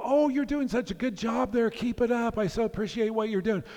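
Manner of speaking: high, bright cheerful call to strangers; mock kind shouting. Street-corner stranger calling praise to a passing worker, high and cheery. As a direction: cheer on a passerby like a street booster. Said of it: "shouting at the top of their lungs very kind things".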